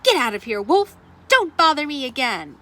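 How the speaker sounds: loud, rude yell from behind the door; angry pig yelling at the wolf; rude shouted answer. Acted. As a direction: shout him away from your door. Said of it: "that rude little porker answered".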